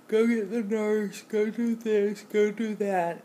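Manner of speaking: loud, high, bossy imitation; demanding roommate. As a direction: mimic the pushy orders.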